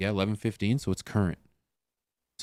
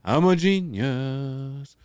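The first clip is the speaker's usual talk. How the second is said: slow, drawn-out boast, pitched up; higher, savoring the victory; self-satisfied triumph; 4 semitones higher, much slower than usual. Real, not acted. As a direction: crow over your own cleverness, stretching "genius". Thinks he solved the screen problem.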